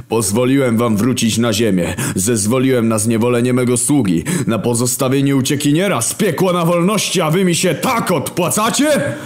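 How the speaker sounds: wounded divine authority; God. Acted. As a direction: speak down from heaven, aggrieved. God reproaches the horsemen.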